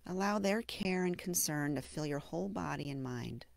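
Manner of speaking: gentle meditation guide with long, settling pauses; guided meditation. Acted. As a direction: ease the listener into self-compassion, unhurried and hushed at the phrase ends. Leads a mindfulness meditation.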